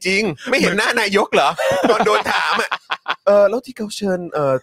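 laughing. Real, not acted. Laughing at the PM's sulky face while telling it.

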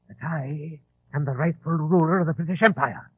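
ordinary talk, nothing marked

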